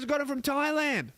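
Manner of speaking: high, pleased announcement; chirpy waitress. Retold or imitated. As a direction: chirp it proudly.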